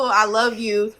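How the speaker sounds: usual speaking voice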